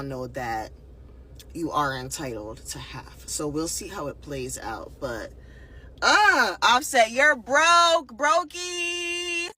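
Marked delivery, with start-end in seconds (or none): 6.0-9.5 high, loud teasing jeer; mocking taunt. Real, not acted. Commentator teasing [name] for being broke, high mocking jeer.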